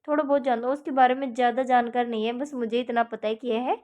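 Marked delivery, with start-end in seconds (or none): none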